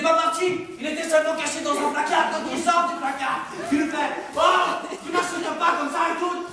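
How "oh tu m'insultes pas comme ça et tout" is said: higher, slow mock outrage; mock angry Néron. Néron stepping out of the cupboard, slow mock outrage. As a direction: act offended, drawn out. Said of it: "il s'énerve".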